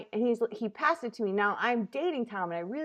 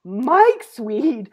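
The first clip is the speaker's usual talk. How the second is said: loud, drawn-out retold alarm; slow, emphatic "oh no" reaction; mock dismay; 7 dB louder, much slower than usual. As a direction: imitate a partner's horrified realisation. Acting Tom's alarmed reaction.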